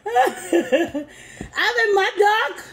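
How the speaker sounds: high, drawn-out words out of a laugh; laugh, then repeat it slowly in high disbelief; amused, laughing. Real, not acted. Laughing at the insult she read.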